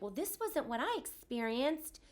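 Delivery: slow, put-on snippy voice; mocking commenter. Grieving mom mimics a critical commenter, mocking. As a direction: parody the know-it-all commenter. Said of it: "getting some comments though".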